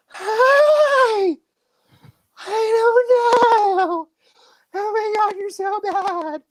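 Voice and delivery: long-drawn, squeaky falsetto impression; Marty's girly phone voice. Brother mocking the voice his sister inherited, exaggerated falsetto. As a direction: stretch every word in a very high mock-sweet voice. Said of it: "apparently her donor gave her the".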